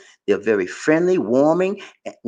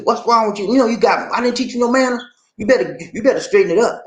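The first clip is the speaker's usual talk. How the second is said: scolding mother; 9 semitones higher than usual. Retold or imitated. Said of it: "I got a talking to".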